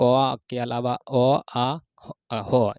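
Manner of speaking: अ आ drawn out very slowly; slow letter demo. Shown as a demonstration. Teacher slowly sounds out throat vowels. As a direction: stretch अ and आ from the throat. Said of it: "बताइए अ".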